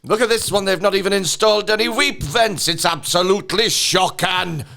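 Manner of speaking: shocked Welsh inspector voice; Welsh accent. Retold or imitated. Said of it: "really strong but beautiful Welsh voice".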